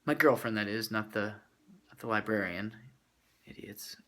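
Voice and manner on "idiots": whisper. Real, not acted. Muttered aside to audience.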